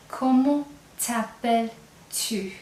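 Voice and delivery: "Comment t'appelles-tu?" drawn out slowly, tu clear at the end; slow it right down so the tu is heard; slow informal demo. Shown as a demonstration. Teacher to class, slowly modelling the informal question. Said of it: "you'll hear that "tu" at the end".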